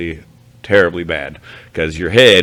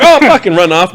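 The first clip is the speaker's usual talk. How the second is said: voicing a bear; 11 semitones higher, 7 dB louder than usual. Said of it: "they might be like".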